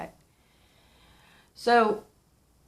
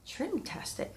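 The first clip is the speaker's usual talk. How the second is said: playful squeak; 10 semitones higher, 9 dB quieter, slower than usual. Acted. Playful word swap on cake name.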